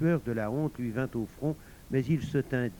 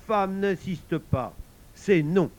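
slow, higher, emphatic; firm refusal; 6 semitones higher, 7 dB louder, slower than usual. A narrator's reading. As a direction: lay down the law, loudest at the end. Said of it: "lui dit-il d'un ton ferme".

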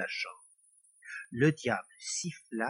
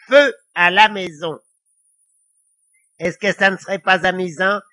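loud, higher devilish urging; scheming devil; 4 semitones higher, 9 dB louder than usual. A narrator's reading. Devil urging Lily to play with matches, gleeful scheming. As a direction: push the wicked plan with relish.

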